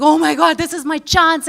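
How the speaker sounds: acted thrill at a lucky break; really happy. Struggling actor hearing of his big chance, overjoyed. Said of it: "like, really happy".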